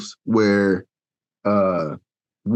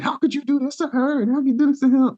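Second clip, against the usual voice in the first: arguing family; 13 semitones higher than usual. Retold or imitated. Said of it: "they be arguing".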